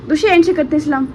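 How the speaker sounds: sing-song mummy voice. Real, not acted. Mother coaxing her baby daughter.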